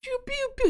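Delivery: high, light rapid-fire "pew" noises; imitated laser shots. Robot spins his torso shooting everyone, high laser noises. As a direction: squeak out a string of blaster shots. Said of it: "he's just like".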